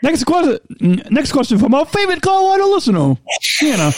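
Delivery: high announcer voice, sing-song; radio voice. Pretend. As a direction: read it like a radio host. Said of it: "do you like my, my radio voice?".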